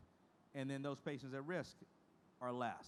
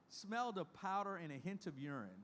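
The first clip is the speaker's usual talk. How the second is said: usual speaking voice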